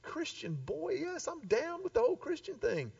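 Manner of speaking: high, quiet, breezy dude voice; mock casual bro. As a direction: play a smug, easygoing churchgoer. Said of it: "And like".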